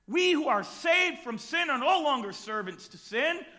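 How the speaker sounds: impassioned sermon, building to shouts; preaching. Acted. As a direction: preach it with fire.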